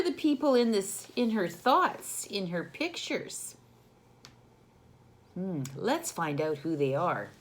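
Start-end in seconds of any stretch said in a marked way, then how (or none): none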